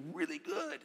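really good squeaked way up high and soft; gushing squeak. Real, not acted. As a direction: gush it like a thrilled fan. Gushing over the book.

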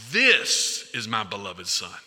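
This is my beloved son with This leaned on, slow and low; stress on This. Shown as a demonstration. Preacher showing the congregation how to stress This. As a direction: land hard on This and let the rest follow slowly. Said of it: "put the emphasis on this".

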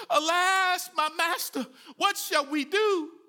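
high, loud cry of alarm; terrified servant shouting for help; panicked cry. Acted. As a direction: cry out in terror to the master. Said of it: "Can't you hear the fear in his voice?".

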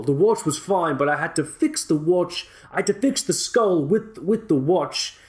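put-on a loose, half-placed South African-ish accent for a joke story; Leonardo DiCaprio Blood Diamond accent. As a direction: tell the skydiving tale in a hammy Blood Diamond accent. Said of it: "my Leonardo DiCaprio Blood Diamond impression".

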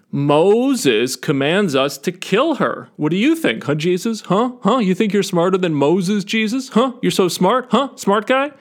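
mock-aggressive needling, pitch climbing as it goes; aggressive heckling. Pharisees challenge Jesus about stoning the adulteress, hammed-up aggressive goading. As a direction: badger him with rising, taunting questions. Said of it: "like, really aggressively".

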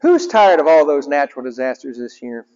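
ordinary delivery

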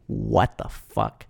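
slow, low, quiet disbelief; drawn-out, low mock dismay; hushed disbelief. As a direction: say it slowly under your breath, stunned. Dismayed reaction to plot gaps.

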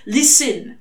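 urgent. A narrator's reading. Demon cutting off the angel: urgent. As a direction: grab his attention urgently. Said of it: "said Crowley urgently".

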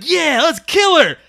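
high, loud, slow gleeful agreement; mock eager killers. Mimicking the family deciding to kill her, slow gleeful voice. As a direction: drag it out with mock glee.

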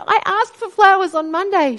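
high, loud and fast, overwhelmed with joy; reliving the tearful rush of joy at the funeral; tearful excitement. Retold or imitated. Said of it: "I'm all tears and crying".

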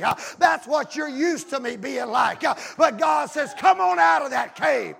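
driving, rhythmic revival sermon; full-force preaching. Acted. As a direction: preach it loud, punching each phrase.

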